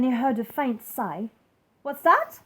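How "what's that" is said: sharp, loud, higher snap; irritated king snaps down the stack; snapping king. Acted. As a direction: bite the question off. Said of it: "snapped the king".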